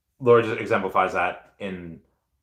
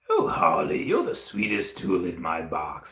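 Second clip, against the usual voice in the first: Joker; 4 semitones lower, 4 dB quieter than usual. Retold or imitated. Streamer retelling the actor teasing Harley, slow Joker voice. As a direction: croon it slowly as a theatrical cartoon villain. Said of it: "Mark Hamill goes".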